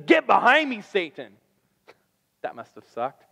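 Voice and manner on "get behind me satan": a higher, louder sharp rebuke, dropping on Satan; stern rebuke. Retold or imitated. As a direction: slap the order down, then land on Satan. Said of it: "sternly rebukes him and says".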